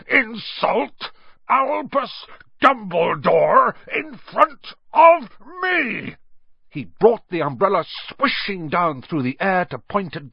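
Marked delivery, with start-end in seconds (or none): 0.1-6.2 high, slow, booming roar; thundering. Acted. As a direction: thunder it as a furious giant. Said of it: "he thundered".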